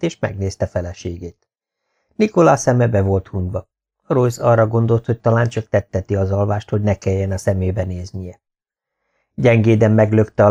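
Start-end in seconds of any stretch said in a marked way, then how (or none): none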